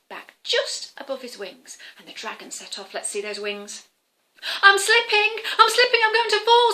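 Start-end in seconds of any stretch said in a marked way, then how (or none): 4.6-6.7 high, loud mock panic; playful high scared squeal; panicked cry. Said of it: "said the Welsh cake".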